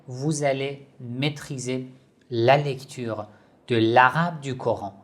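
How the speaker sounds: ordinary talk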